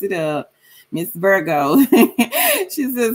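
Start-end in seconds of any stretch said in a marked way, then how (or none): 1.8-2.6 high, loud laugh; burst of laughter. Real, not acted. Laughs at a viewer's name.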